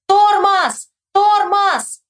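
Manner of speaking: high, drawn-out holler; high repeated shout with falling end; yelling. A narrator's reading. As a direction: bellow each word as a warning. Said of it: "и орёт".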